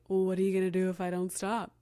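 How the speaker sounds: mimicked tough-kid challenge; imitating a child daring a parent; defiant child as mafia boss. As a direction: put on a little mob boss's taunting voice. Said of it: "like, the leader of the mafia or something".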